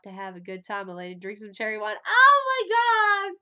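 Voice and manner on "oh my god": high, loud, drawn-out cry; thrilled by a song starting; excited shriek. Real, not acted. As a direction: squeal with delight. Reacting to song.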